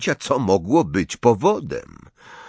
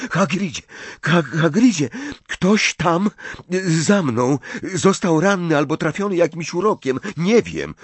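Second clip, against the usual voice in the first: higher, halting and flustered; urgent alarm; 6 semitones higher than usual. Acted. Harry reports someone hurt to Hagrid, flustered and alarmed. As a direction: stammer out the bad news urgently.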